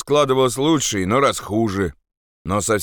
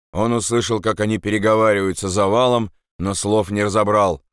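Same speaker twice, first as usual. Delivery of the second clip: plain reading voice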